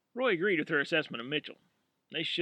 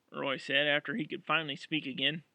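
ordinary delivery

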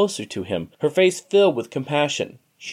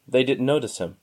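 ordinary delivery, nothing marked

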